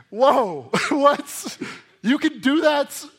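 high, loud mock disbelief; astonished reaction. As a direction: burst out amazed, with laughter breaking in. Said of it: "I'm just kinda like".

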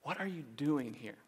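very soft, hushed questioning; gentle hushed voice; whisper. Acted. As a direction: drop to a near-whisper, leaving room between the questions. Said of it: "in a whisper".

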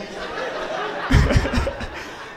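long breathy laugh; laughter. Real, not acted. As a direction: laugh softly at the absurd joke. Laughing at slashed-tires joke.

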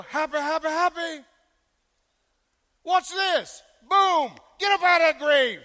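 mocking cheery miracle worker. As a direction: shout it as a showy, carefree miracle man. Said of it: "He didn't show up and go".

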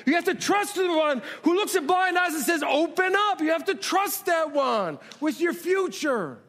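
high, rising preacher's drive, each line hammered home; preaching at full force. Acted. Pastor urging the church to trust God with their future, in full preaching cry. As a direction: build the congregation up, line after line.

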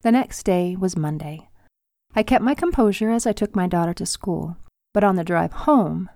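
usual speaking voice

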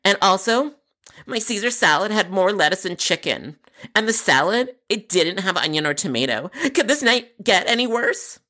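high-pitched, indignant customer; put-on voice of an aggrieved caller; complaining customer.

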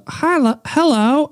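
high puppet voice; testing a high Elmo voice; Elmo voice try. Pretend. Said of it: "what does Elmo sound".

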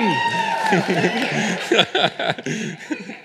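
long, high laugh at himself; real laugh. Real, not acted. Preacher after his botched Scottish accent, laughing. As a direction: laugh off your own bad impression.

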